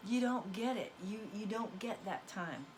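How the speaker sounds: usual speaking voice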